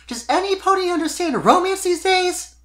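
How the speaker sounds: high, loud, exasperated outburst; exclaiming. Acted. Sweetie venting to Spike about romance, loud high exclaim. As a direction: throw the question up in the air. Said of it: "she exclaimed".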